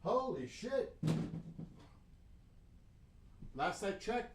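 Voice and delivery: high, surprised exclamation. Real, not acted. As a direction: react to an unexpected score. Surprised at hockey score.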